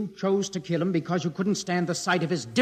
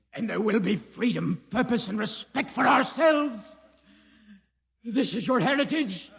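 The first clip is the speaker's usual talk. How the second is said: usual speaking voice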